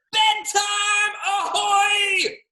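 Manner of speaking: high, loud drawn-out call; hearty octopus holler. Acted. Octopus calls the boy to bed, high gleeful shout. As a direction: sing out a ship's bedtime call. Octopus announcing bedtime happily.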